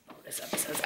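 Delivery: hushed whisper to herself. Shown as a demonstration. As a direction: breathe it as a whisper.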